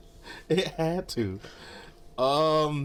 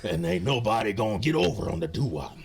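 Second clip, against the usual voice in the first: mock wrestling promo; 7 semitones lower than usual.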